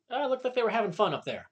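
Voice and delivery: quick, high, sugary mock compliment; mock audience praise. Drama teacher mimics parents after a weak show, high and quick. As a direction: rattle it off high like a parent being kind. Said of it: "We always say".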